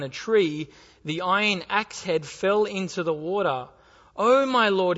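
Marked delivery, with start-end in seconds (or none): 4.2-5.0 a cry of dismay; cried out. Acted. Prophet crying to Elisha in dismay. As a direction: cry it out in alarm. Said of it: "he cried out".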